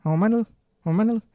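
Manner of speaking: "Mau mana lu?" rattled off high with "ke" swallowed; casual contraction. Shown as a demonstration. Teacher showing how Indonesians really talk, contracted "Mau mana lu?". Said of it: "kalau kita ngomong".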